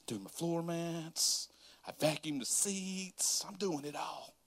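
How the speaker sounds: whispered, breathless. Real, not acted. The pastor telling the church how tired he was, whispered. Exhausted cleaning car.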